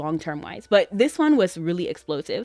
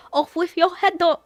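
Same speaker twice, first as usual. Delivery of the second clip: higher, cute imitation of the line; cute Riddle imitation; 10 semitones higher than usual. Showing viewers how cute Riddle says it, higher. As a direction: coo it like an adorable little tyrant. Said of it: "is really cute".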